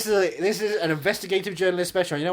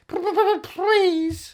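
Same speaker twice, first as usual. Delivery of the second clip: high stuttering cartoon rabbit; Roger Rabbit voice; 7 dB louder, much slower than usual. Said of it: "do it in Roger Rabbit voice".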